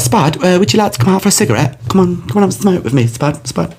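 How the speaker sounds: high-pitched Essex mum, coaxing; Essex accent. Retold or imitated. Friend's mum inviting Spud for a cigarette, high Essex accent. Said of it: "so this accent's spot on".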